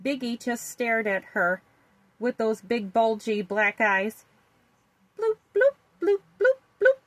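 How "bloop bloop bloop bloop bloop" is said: high, loud bubbly bloops; a big fat fish blooping hello. A narrator's reading.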